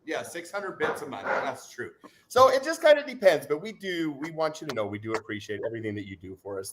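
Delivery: ordinary talk, nothing marked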